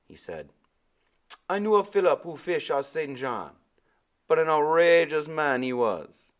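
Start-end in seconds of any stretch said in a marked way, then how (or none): 1.5-6.3 put-on high, loud island-accented old man; old Caribbean sailor. Old sailor answering the boy, Caribbean accent. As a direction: give Timothy his lilting Caribbean voice.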